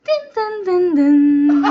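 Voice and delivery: descending "din din" jingle; sung cabin chime. Pretend. Ending the cabin announcement, sung descending chime. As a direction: voice the airline chime, last note held.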